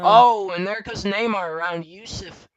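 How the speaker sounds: loud, high overtake call; excited race call. Real, not acted. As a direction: shout the overtake as it happens. Overtake called live.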